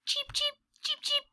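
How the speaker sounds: high, soft, tiny cheeping; baby bird chirps. Acted. Narrator voicing the hatched chicks, high and soft tiny cheeps. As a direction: peep like a newborn chick. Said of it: "And they say".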